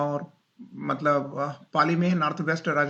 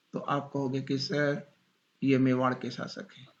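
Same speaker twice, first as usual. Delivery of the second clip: ordinary talk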